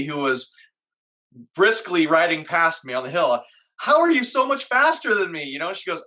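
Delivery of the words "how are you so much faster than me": high, incredulous asking; amazed question. Retold or imitated. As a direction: ask in disbelief, pitched up. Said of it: "I actually turned and asked this".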